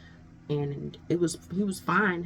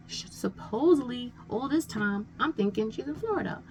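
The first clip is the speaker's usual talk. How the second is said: incredulous; 7 semitones higher than usual. Real, not acted. Disbelief at his money request.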